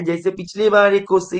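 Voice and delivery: ordinary talk, nothing marked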